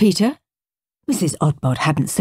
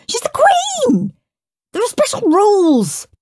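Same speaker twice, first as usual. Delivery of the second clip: mock-awed; 4 dB louder than usual. Henry scaring Peter with fake royal awe. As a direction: overplay the awe to scare him.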